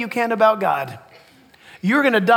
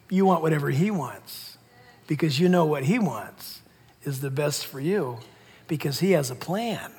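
ordinary delivery

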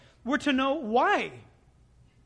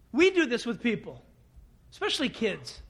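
ordinary talk

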